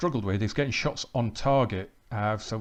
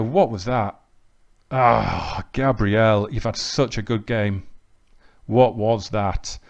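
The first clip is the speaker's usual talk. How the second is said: louder, dismayed groan at the mistake; exasperated disbelief; 6 dB louder, slower than usual. Real, not acted. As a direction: ask it in frustrated disbelief. Disbelief at a defender's error.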